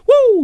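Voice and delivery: high-pitched loud "woo"; loud whoop. Shown as a demonstration. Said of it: "I make noises really loudly sometimes".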